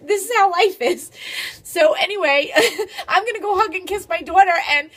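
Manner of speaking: mother wrapping up the video, overjoyed and teary; giddy joy. Real, not acted. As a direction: sign off still bubbling with pride. Still overjoyed after the news.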